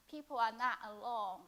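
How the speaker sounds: ordinary talk, nothing marked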